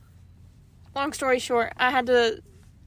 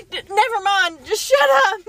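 raised, building mock exasperation; retold anger; 5 semitones higher, 5 dB louder than usual. Wife fed up with questions, mock angry shout. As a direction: cut it off crossly, rising to a yell. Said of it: "getting more and more mad".

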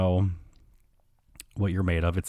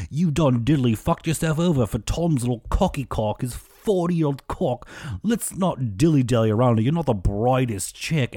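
higher-pitched mock British accent; Ned Flanders; 4 semitones higher than usual. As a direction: ham up a fussy British accent. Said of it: "like, Ned Flanders, like, British mode".

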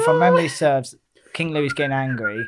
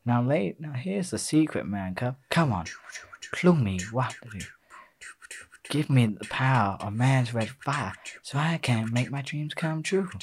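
low, soft character speech; lightly acted, confiding film dialogue; movie speech; 3 semitones lower, 8 dB quieter than usual. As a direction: quietly recite the jungle king's lines. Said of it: "do the speech, do the speech".

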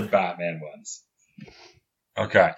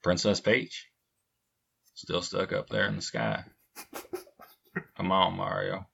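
easy, laid-back Texan hero talk; McConaughey as Luigi; 3 semitones lower, 10 dB quieter than usual. Acted. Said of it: "Matthew McConaughey as Luigi".

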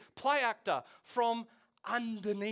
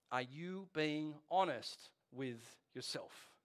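slow, measured, with weighted pauses between words; slow probing question; 4 semitones lower, 4 dB quieter, much slower than usual. Acted. Preacher voicing Jesus's question to listeners, slow and probing. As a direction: put the question slowly, lower and deliberate.

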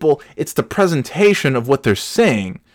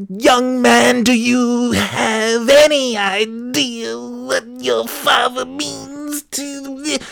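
very high, drawn-out screechy wail; hammed-up church lady shrieking slowly; mock yelling woman; 13 semitones higher, much slower than usual. Church woman accosting the preacher's son, mock yelling. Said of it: "you don't have to yell at me".

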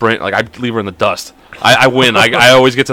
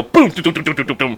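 a boom, then quieter rapid bumps; imitated tumbling; 3 semitones higher, 3 dB quieter than usual. Dad imitates being tripped on the stairs, imitated tumbling. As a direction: mimic bumping all the way down.

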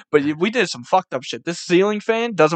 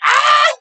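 high, loud burst of laughing shriek; imitated laughing shriek; 7 dB louder than usual. Friend cracking up at the counter, high laughing shriek. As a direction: squeal like a kid losing it with laughter. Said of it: "he fucking cracks up".

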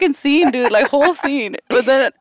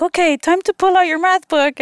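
mock teacher voice; 9 semitones higher than usual. Sisters voiced telling her pull book.

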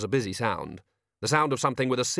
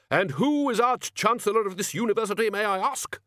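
loud, much higher indignant bluster; pompous wizard; 11 semitones higher, 5 dB louder than usual. A narrator's reading. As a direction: bluster like an offended headmaster. Ridcully pompous rhetorical speech.

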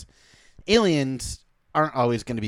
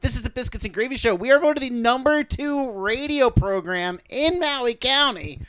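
radio announcer; 9 semitones higher, 3 dB louder than usual. Acted. Mock radio station announcer.

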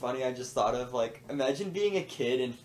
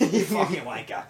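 mock British insult; 3 semitones higher than usual. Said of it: "That's really good".

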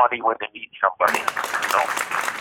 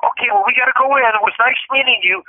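higher-pitched, quick polite goodbye; imitated woman; 10 semitones higher, faster than usual. Woman excusing herself from new acquaintance, imitated high and quick. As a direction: imitate her in a raised, hurried voice. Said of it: "she goes".

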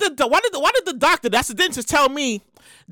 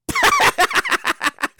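rapid, high-pitched burst of laughter; high cackling laugh. Real, not acted. Host cracking up before the show starts, high cackle. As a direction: cackle in a long staccato run.

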